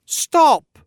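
sharp high shout of stop; cried out. Acted. Narrator shouts at the screaming ghost to stop. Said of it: "I cried out".

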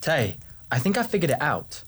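high, hesitant character voice; somewhat tentative. A narrator's reading. Nasteri tells Tay the theory, tentative. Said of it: "in a somewhat tentative manner".

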